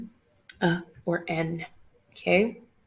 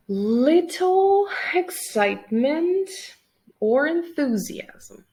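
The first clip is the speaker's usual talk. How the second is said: drawn-out; 7 semitones higher, 4 dB louder, slower than usual. Shown as a demonstration. Teacher dramatizes a dull definition.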